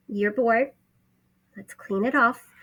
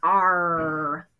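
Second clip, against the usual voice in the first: growled pirate arr; pirate sound; 2 semitones lower than usual. Shown as a demonstration. Teacher showing first graders a pirate arr. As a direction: say it like a pirate would. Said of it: "makes a pirate sound".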